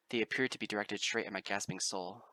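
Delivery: ordinary delivery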